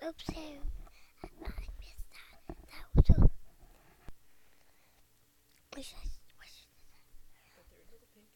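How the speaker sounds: hushed aside to the room; whispering. Real, not acted. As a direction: whisper the slip under your breath. Whispered aside mid-reading.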